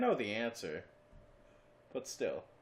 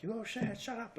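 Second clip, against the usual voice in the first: much higher, mock-scolding the dog; 13 semitones higher than usual. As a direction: scold the dog up high, half-joking. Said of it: "I'm like".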